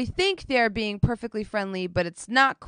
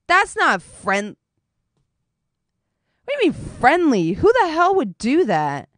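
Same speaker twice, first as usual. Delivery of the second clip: louder, raised voice; arguing with her friend, louder and exasperated; exasperated outburst; 6 semitones higher, 7 dB louder than usual. Retold or imitated. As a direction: snap back in exasperation. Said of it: "I was like".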